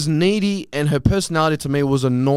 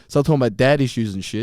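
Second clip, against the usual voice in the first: ordinary talk, nothing marked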